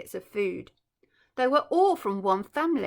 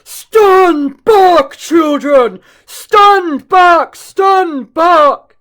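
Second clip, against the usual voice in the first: huge, much louder, higher warning shout; elephant shouting; 6 semitones higher, 17 dB louder, much slower than usual. Acted. Chunky warning the children off the crocodile, booming shout. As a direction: bellow a warning across the jungle. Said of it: "a big, deep voice from the jungle shouted".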